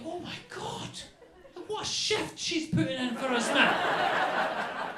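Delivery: high, loud, slow mock amazement; drawn-out marvelling at the dog's effort; mock awe. Comic marvelling at his dog's round-the-clock guard duty. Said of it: "So then I was like".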